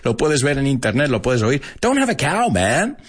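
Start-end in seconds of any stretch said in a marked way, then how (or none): 1.8-3.0 very high cartoon boy voice; Bart Simpson. Host demonstrating the famous catchphrase, high cartoon boy. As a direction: pitch it way up, cheeky catchphrase. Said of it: "Bart Simpson who said".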